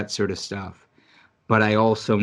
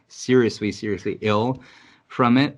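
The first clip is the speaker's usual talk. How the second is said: ordinary talk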